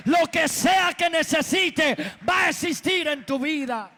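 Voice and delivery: forceful, driving sermon delivery; impassioned preaching. Real, not acted. Preacher teaching the congregation that only God creates, impassioned preaching. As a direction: preach it hard, leaning on key words.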